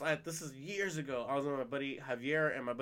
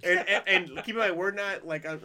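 ordinary talk, nothing marked

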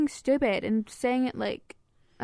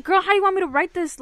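louder, higher exasperated retelling; retold frustration; 8 semitones higher, 7 dB louder than usual. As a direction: replay your exasperated plea, pitched up. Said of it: "Like, I was so frustrated".

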